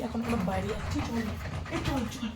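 very soft, hushed sneaking voice. Real, not acted. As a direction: keep it down while slipping out. Sneaking out, locking the aunt in.

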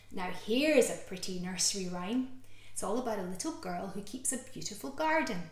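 plain reading voice

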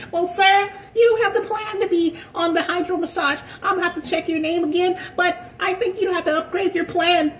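very high, prissy mock voice; gym Karen voice. Karen asking for his last name, in a very high prissy voice. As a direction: squeak it like a fussy desk clerk. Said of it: "she came out there".

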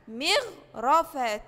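مغرفة stretched slowly; model word. Shown as a demonstration. Teacher to first graders, slow model word. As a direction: draw out مغرفة for the class. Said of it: "زي كلمة".

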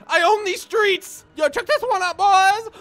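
high triumphant shouting. Real, not acted. Gamer losing the cops, high triumphant shout. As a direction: crow over the win. Winning chase.

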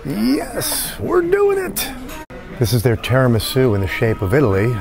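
ordinary delivery

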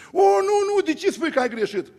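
surprised protest. Retold or imitated. Senator protesting to the preacher, surprised. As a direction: protest in surprise, starting high.

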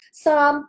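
三 held high and flat, Cantonese first tone; 三 said high and level as tone one. Shown as a demonstration. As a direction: sing 三 on one high steady note. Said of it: "第一声呢其实是很高音的".

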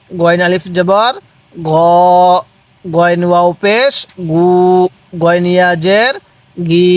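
spelling names lilt upward, then "গি" held level; measured drill with long "গু"; drawn-out vowels. Shown as a demonstration. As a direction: lead a read-along chant, holding "গ", "গু", "গি". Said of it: "পড়ুন আমার সাথে সাথে".

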